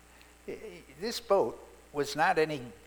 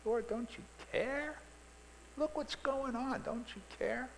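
high, pleading cry to the Lord; desperate plea; 10 semitones higher, 7 dB quieter than usual. Retold or imitated. Believer pleading with Jesus, high and desperate. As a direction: ask it like a frightened believer begging. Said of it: "That's a question we often ask".

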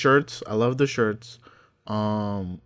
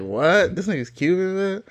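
retold disbelief, higher and louder; surprised reaction; 9 semitones higher, 6 dB louder than usual. Recalling his shock at Tony's nationality, surprised. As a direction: exclaim it in mock disbelief.

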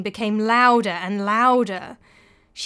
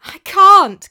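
pleading; 10 semitones higher, 16 dB louder than usual. Acted. Frightened widow at the door.

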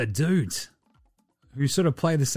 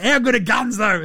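high, proud showman voice; hammed-up player boasting; mock boast; 8 semitones higher than usual. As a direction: brag like a clueless star. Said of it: "he's like".

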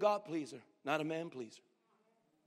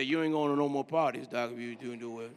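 low neighborly aside; 9 semitones lower than usual. Pretend. Churchgoer tells neighbor no more parties, low aside. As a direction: drop right down low, as if tipping off a buddy.

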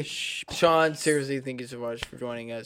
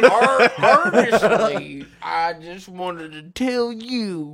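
slow, slurred "Ar-, honestly" said higher and louder; mock drunk; 4 semitones higher, 9 dB louder, much slower than usual. Friend mocking drunk speech to the podcast guest, tipsy and slurred. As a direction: ham up a tipsy drawl, laughing. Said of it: "how I say your name when I'm drunk".

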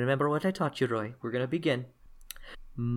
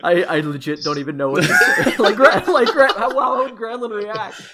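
higher and louder, giggling through the words; laughing; 8 semitones higher, 6 dB louder than usual. Real, not acted. GM laughing, unsure how Granlund reacts. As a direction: fumble for words while laughing.

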